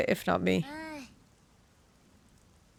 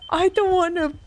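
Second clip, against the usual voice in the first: high, slow, appalled refusal; horrified no, higher and slow; horrified protest; 6 semitones higher, much slower than usual. Real, not acted. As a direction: cry out against it in horror. Refusing to sacrifice a kid.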